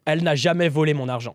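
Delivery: said in even, quick French. Shown as a demonstration. Speaker showing how French says it, even French. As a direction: native French, no word stressed. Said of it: "In French, you would say".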